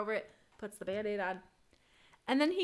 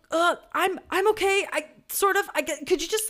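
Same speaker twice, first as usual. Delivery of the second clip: higher, louder, stumbling over the words; a jittery patient dreading the needle; nervous stammer; 8 semitones higher, 6 dB louder than usual. Retold or imitated. Patient stammering to the vaccinator, high-strung and nervous.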